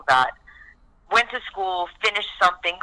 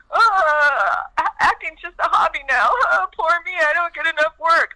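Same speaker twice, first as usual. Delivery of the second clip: mock self-pity; 11 semitones higher, 4 dB louder than usual. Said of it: "mocking her own self-pity".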